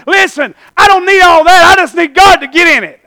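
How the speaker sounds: loud, high preacher's cry; shouted rebuttal. Acted. Believer answering negative voices, shouted rebuttal. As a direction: cut off the doubters at full volume.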